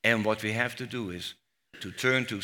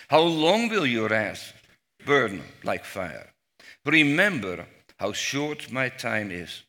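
a raised, fervent scripture reading; psalm reading; 4 dB louder than usual. Acted. Teacher reading the psalm aloud to the class, fervent and pleading. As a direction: read the lament as if pleading.

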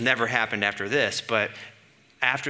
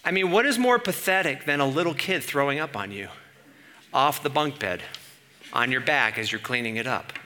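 ordinary talk, nothing marked

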